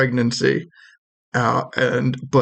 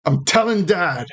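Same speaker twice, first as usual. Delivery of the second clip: put-on higher, whiny kid-brother tattling; annoyed little brother; 9 semitones higher than usual. Game master voicing the shopkeeper's brother, high and whiny. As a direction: threaten to tell Dad like a bratty sibling.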